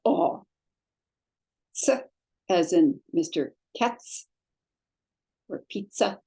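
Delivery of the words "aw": aw said from the back of the throat; throaty demonstration; guttural aw. Shown as a demonstration. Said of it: "the back of your throat".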